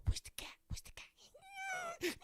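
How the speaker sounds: high, breathy whisper urging a dog on; whispered dog egging. Retold or imitated. Owner hyping up his dog to chase a cat, high whisper. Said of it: "this happens now".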